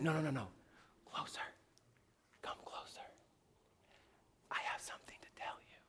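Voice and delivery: hushed, voiceless beckoning; soft murmur sinking into a whisper; gentle whisper. Acted. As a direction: whisper to draw him nearer. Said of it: "the sound of a gentle whisper".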